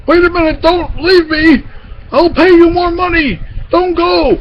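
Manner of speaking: very high panicky gorilla voice; desperate pleading. A narrator's reading. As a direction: beg your fleeing army to stay. Gorilla begs fleeing army to stay.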